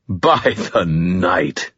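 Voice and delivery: put-on slow, dry amused old voice; dusty, amused. A narrator's reading. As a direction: savor it like a dusty old ghoul. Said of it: "his voice dusty and amused".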